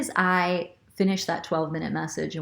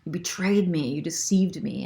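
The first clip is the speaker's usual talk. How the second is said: slow, louder, accusing outburst; wounded ego's accusation; 6 dB louder, slower than usual. Retold or imitated. To the deceiving ex, slow louder accusation. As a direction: spit it out like a betrayed ego. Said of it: "the egoistic part of me feels".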